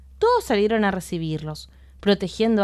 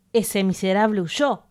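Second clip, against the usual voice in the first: plain reading voice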